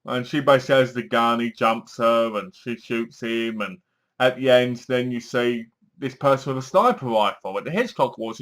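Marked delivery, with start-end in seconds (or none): none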